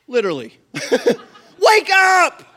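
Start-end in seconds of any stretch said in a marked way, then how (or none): 1.6-2.4 high loud yell; panicked shout. Retold or imitated. Disciples screaming at Jesus to wake, panicked shout. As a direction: shake him awake with a yell. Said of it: "They're freaking out".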